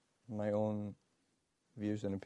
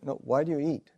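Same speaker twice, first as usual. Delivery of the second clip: insisting louder; 5 semitones higher, 9 dB louder, faster than usual. Retold or imitated. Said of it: "We said".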